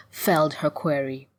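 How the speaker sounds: ordinary delivery, nothing marked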